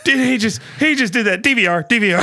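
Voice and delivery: high, loud excited outburst. Retold or imitated. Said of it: "and it's like".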